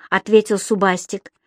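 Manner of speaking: plain reading voice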